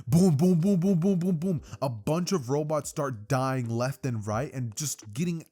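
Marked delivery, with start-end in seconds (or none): 0.1-1.6 loud, higher string of spoken explosions; punchy repeated boom sound effects; rapid-fire booms. A narrator's reading. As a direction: imitate a chain of blasts, easing slightly. Imitated explosions.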